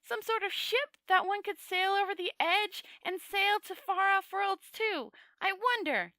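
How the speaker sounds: high voice, musing aloud; little man dreaming of other worlds; thoughtful little man. A narrator's reading. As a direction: think it through in a high eager voice. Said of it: "said the little man thoughtfully".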